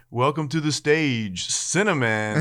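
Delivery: slow, drawn-out announcer voice; lightly voiced club emcee; strip club announcer. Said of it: "kept waiting for him to say".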